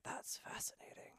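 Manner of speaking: breathy whispered aside. Retold or imitated. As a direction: whisper it under your breath.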